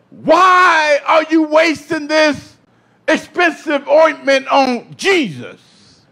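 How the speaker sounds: slow, drawn-out mock objection; indignant referee. Preacher voices referees objecting to the ointment, slow mock indignation. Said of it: "the spiritual referees".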